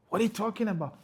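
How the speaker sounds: high-pitched, incredulous challenge; high, exasperated scolding; indignant rebuke. Acted. Rebuking an imagined poem reciter.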